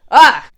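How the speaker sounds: sudden loud cry of frustration; frustrated yell. Real, not acted. YouTuber groaning that Christmas is near, yelling. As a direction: burst out in a short frustrated yell.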